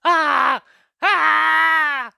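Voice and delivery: loud, high cries of pain from a crushed leg; two sharp, high, loud yells of pain; retold pained screaming. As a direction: scream out in agony, hammed up. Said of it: "He's screaming".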